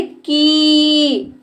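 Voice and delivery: की with a long held vowel. Shown as a demonstration. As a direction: stretch the vowel of की. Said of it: "इसके उच्चारण में ज़्यादा समय लगता है". (देर तक खींचा हुआ की)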